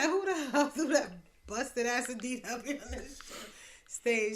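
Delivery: loud, high, laughing outrage; angry shout. Retold or imitated. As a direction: yell it half laughing at the crowd. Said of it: "He said".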